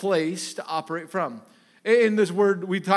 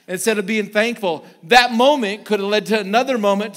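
much louder, higher, driving sermon; preaching at full force; 4 semitones higher, 8 dB louder than usual. Acted. Pastor drives home the lesson to the congregation, preaching loud. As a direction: build the point like a pastor.

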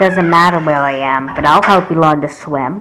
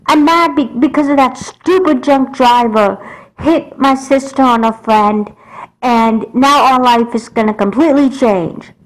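angry rant; 6 semitones higher than usual. Acted. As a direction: let the anger spill out at mom. Child venting anger at mom.

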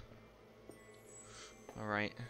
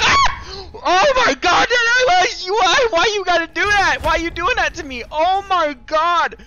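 high, loud, wailing panic; horrified screaming; 13 dB louder than usual. Real, not acted. Player wailing at the game's sudden shock, horrified screaming.

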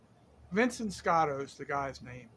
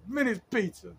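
put-on answering the phone in a thick Sicilian accent, a touch higher; heavy Sicilian accent; 4 semitones higher, 3 dB louder than usual. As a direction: imitate a pizza guy's heavy Sicilian accent. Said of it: "real heavy Sicilian accent".

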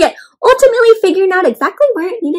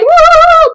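high, loud, drawn-out whoop; cheering whoop; 14 semitones higher, 7 dB louder than usual. A narrator's reading. Storyteller acting out the class cheering, loud whoop. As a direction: cheer like a delighted audience. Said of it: "everybody clapped and cheered".

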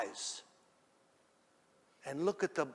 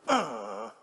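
short, low, heavy grunt; low effortful "ugh"; 5 semitones lower, 4 dB louder than usual. Acted. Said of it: "Peter goes".

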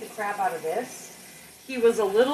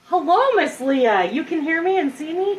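excited greeting; 7 semitones higher, 7 dB louder than usual. Real, not acted. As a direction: greet a friend with delight. Greeting a live viewer.

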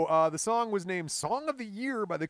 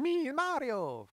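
high, slow mock Italian accent; hammed-up Mario-style Italian; 10 semitones higher, 4 dB louder than usual. Riffing on Italian jokes, a mock Italian accent. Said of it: "It's the Italian".

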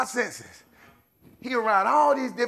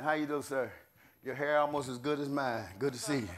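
soft, low teasing encouragement; gentle aside; 7 semitones lower, 10 dB quieter than usual. Real, not acted. Preacher teasing a man in the pews about his hair, soft and friendly. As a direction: quietly reassure him, friendly.

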